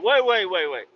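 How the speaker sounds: loud, urgent call to stop him leaving. Retold or imitated. The guy calls after the storyteller to stop him, urgent loud call. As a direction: shout after him, don't let him go.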